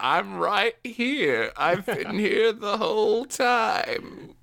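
put-on high, slow old-man caricature. Retirement-home resident answering the intruder, hammed-up old man. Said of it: "in this retirement home".

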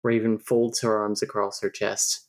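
ordinary talk

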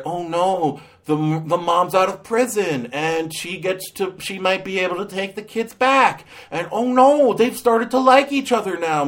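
high-pitched, hammed-up dismay; mock melodrama. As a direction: fret like an overwrought soap narrator. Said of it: "all sad and depressing".

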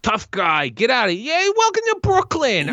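high, hammed-up Brooklyn tough guy; exaggerated Brooklyn shout-out; Brooklyn accent. Shown as a demonstration. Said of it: "Like that".

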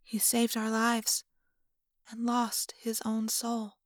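slow, soft lament; hushed regret, fading almost to a whisper; deeply sad. A narrator's reading. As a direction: let it out slowly and softly, with sorrow. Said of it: "smiling a deeply sad smile".

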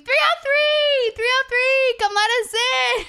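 very high, loud, drawn-out chant; full screaming. Retold or imitated. Yelling at party room to let them in, screaming. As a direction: holler the room number up at the window.

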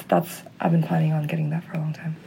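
secret whisper. Real, not acted. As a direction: whisper it so mom can't hear. Secret kept from her mom.

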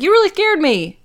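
high, loud imitated protest; hammed-up scared complaint; mock upset. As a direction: cry it out high, imitating her. Joan reacting to fright.

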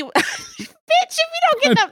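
laughing outburst. Real, not acted.

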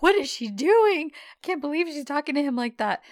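high-pitched acted disbelief; incredulous bystander, played up; mock scandalized. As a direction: gasp it like an appalled onlooker. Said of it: "everybody's scandalized".

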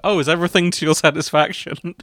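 chirpy waiter imitation; a hint of a fussy waiter; polite waiter. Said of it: "a waiter is always like".

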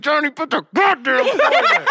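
high, laughing mock scold. Cashier telling Johnny to drop the toy, laughing high. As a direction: scold the kid while cracking up.

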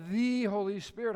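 loud, stretched "the" before Holy Spirit; stress on "the". Shown as a demonstration. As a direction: make "the" stand alone, pronounced thee. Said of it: "There's the. Everybody say the".

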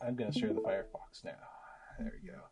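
soft whisper to yourself; whispered aside. Real, not acted. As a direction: breathe it while you click. Muttering while sharing screen.

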